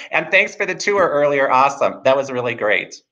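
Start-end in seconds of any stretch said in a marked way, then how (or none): none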